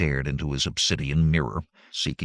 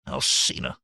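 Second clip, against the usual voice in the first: sharp; 7 semitones lower than usual. A narrator's reading. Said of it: "The sharpness of the sorcerer's tone".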